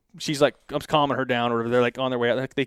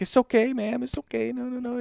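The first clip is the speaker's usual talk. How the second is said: high, softened imitated reassurance; calming her down; 10 semitones higher, 3 dB quieter than usual. Officer soothes the distraught wife, imitated hurried calming. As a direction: mimic a flustered officer soothing someone. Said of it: "calming her down".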